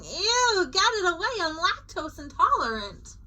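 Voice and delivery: high, whiny ew; disgusted. A narrator's reading. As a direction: recoil from the cheese in disgust. California disgusted by cheese.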